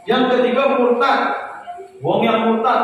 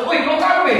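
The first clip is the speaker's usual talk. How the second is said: higher-pitched, curt call-out; confrontational intonation; 6 semitones higher, slower than usual. Shown as a demonstration. Preacher showing how the Prophet's preaching did not sound, confrontational. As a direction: demonstrate a rude way of addressing someone. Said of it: "Intonasi dakwahnya Rasulullah tidak masuk".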